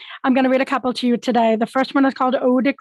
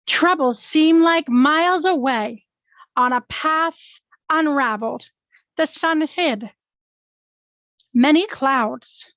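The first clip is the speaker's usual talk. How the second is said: slow, drawn-out verse with swooping pitch; poem recital; 2 semitones higher, slower than usual. Acted. Poet reading a poem about today, slow poetic recital. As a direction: linger on each phrase of the poem.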